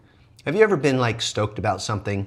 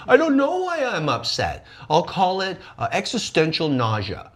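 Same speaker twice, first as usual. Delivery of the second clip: mock confused whine; 6 semitones higher, 4 dB louder than usual. Mock confused person quote.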